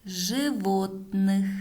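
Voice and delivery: word by word with long pauses; dictating. Shown as a demonstration. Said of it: "последнее предложение". (диктует по словам)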